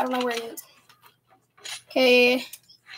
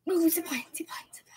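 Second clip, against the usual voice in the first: excited whisper; 3 semitones lower, 14 dB quieter than usual. Real, not acted. Spotting a zip line.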